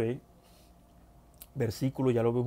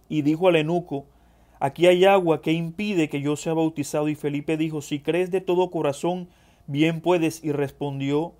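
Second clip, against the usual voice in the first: projected, slightly raised reading voice; scripture reading; 3 semitones higher, 7 dB louder than usual. Acted. Preacher reading the eunuch's baptism to the brethren, scripture reading. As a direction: read the Bible story out firmly. Resumes reading the passage aloud.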